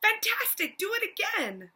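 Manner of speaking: high, loud cheering; excited shout. Acted. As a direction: egg the dragon on with a loud cheer. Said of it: "But Elizabeth shouted".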